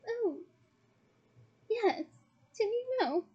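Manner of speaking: put-on high-pitched, girlishly coy reply; giggly. A narrator's reading. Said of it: "most un-Hermione-ish giggle".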